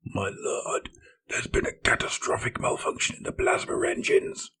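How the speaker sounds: frantic, breathless whisper of a report; panicked. Acted. Terrified serf reporting engine failure to his lord, panicked whisper. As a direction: gasp out the bad news in terror. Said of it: "a panic serf shouted".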